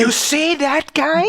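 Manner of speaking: loud, high stage whisper; whisper yelling. Retold or imitated. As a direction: hiss it loud enough for all to hear. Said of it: "He's whisper shouting though".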